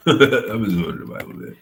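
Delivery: laughing. Real, not acted. Streamer repeats to chat, laughing at himself.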